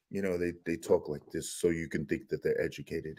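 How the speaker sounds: very low, soft, pompous murmur; mock the fake-smart panelists in a deep hushed tone; pseudo-intellectual voice. Vlogger imitating pompous panelists, low hushed voice.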